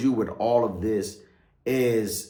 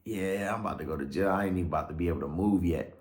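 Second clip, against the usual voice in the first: low, soft self-talk; dejected inner thought; 4 semitones lower, 6 dB quieter, faster than usual. Retold or imitated. Cuffed, thinking the move is off, quietly dejected. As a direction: let it sink, quieter and lower. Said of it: "all I could think about".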